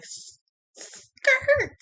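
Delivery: high loud "skirt!" squeal; exclaiming. Retold or imitated. High shriek reacting to his girlfriend news. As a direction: shriek it like brakes slamming.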